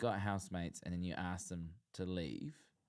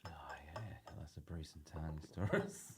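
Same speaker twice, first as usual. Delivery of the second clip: whisper; 4 semitones lower, 7 dB quieter than usual. Real, not acted. Whispered aside about housemates.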